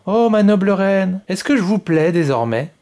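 shyly fish for her approval; clumsy hopeful little king; awkward plea. Acted. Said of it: "demanda-t-il avec maladresse".

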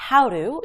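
"how to" run together as "how-ru"; flapped T in how to. Shown as a demonstration. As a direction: soften the t of "how to" to a tapped r. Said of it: "이런 식으로".

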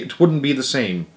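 usual speaking voice

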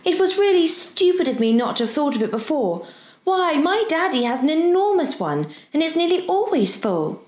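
put-on high, excited child's voice; eager helpful girl. Girl promising the professor a basket, eager. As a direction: chatter on keenly, pitched high. Diana's voice in the story.

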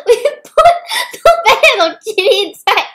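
loud high words through laughter; laughing. Real, not acted. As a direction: cackle while confessing. Confessing the prank.

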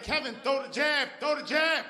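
high, urgent imitation of a trainer; mock urgent coaching yell; trainer calling out. Trainer shouting from the corner.

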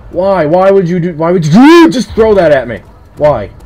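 loud, high outraged wail; yelled protest. Real, not acted. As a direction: demand an answer from the attacker. Protests after thing thrown at player.

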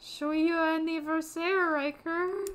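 high, slow, drawn-out character voice; exaggerated high-pitched celebration; cheery birthday presenter. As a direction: present it with loud, hammed-up festivity.

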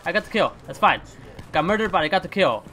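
ordinary delivery

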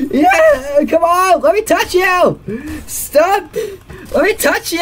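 high, squealed pleading; excited begging. Real, not acted. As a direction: beg in an overexcited high voice. Pleading excitedly with game figure.

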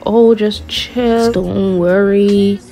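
very slow, laid-back imitation; drawn-out reassurance. Date brushing off where they are going, lazily reassuring. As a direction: stretch every word as a mock soothing brush-off.

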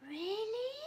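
high, soft surprised gasp. Acted. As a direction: gasp it in wonder. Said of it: "gasped Ferret".

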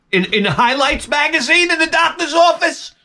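high, incredulous outburst; exasperated yell. Acted. Comedian scoffs at coworker's lame joke, exasperated yell. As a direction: jump high in comic disbelief.

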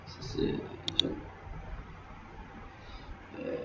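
low, soft mutter while writing; muttering to self. Real, not acted. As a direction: trail along quietly, half to yourself. Talking to self while writing out example. (marmonner tout bas pour soi)

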